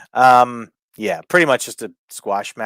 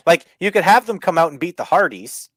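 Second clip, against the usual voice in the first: normal talking voice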